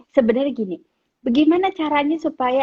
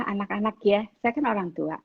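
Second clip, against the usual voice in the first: ordinary talk, nothing marked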